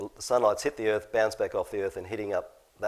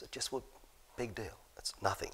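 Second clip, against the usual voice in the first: hushed dismissal; 13 dB quieter than usual. Real, not acted. Quiet dismissive aside after quote.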